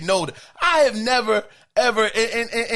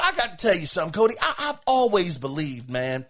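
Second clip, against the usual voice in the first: ordinary talk, nothing marked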